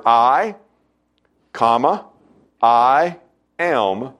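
I punched, then a pause before am; emphatic I am. Shown as a demonstration. Preacher showing the congregation the emphatic I am. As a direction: hit the word I, pause, land am. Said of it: "to make it extraordinarily emphatic".

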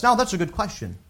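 ordinary delivery

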